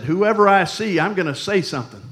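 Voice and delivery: acted-out grumble, announced loudly; complaining out loud. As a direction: raise your voice in mock complaint. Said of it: "ready to complain, you know, just out loud".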